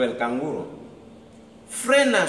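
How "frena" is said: high, loud plea; pleading lake. Acted. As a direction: beg him to stop. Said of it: "suplicó el lago".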